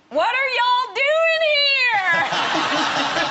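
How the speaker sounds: high, loud, slow Southern drawl; Southern waitress. Retold or imitated. Waitress from Thibodaux, amazed, in a high slow Southern voice. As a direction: stretch it out like a small-town Southern waitress. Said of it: "And she went".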